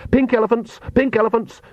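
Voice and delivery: high, insistent distraction; acted urgent babble to drown out a thought. A narrator's reading. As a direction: chant it urgently, pitched well up. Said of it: "said Nanny urgently".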